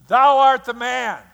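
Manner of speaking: loud, high ringing accusation; prophet's shouted accusation. Retold or imitated. Prophet Nathan shouting at King David. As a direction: thunder it at the king like a verdict.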